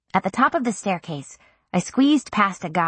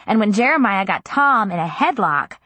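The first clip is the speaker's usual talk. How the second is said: usual speaking voice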